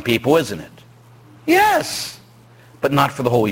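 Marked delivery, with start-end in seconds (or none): none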